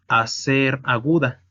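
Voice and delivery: ordinary delivery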